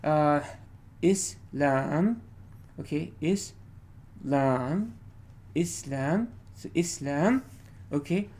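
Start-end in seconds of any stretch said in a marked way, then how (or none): 1.0-2.2 "Islam" with a long, rising "lam"; "Islam" slowly, stressed on the last syllable; second-syllable stress. Shown as a demonstration. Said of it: "there are two ways to pronounce this". 3.2-7.4 "Islam" repeated, each time rising on "lam"; short "Is", stressed rising "lam" in every "Islam"; second-syllable stress. Shown as a demonstration. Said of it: "there are two ways to pronounce this".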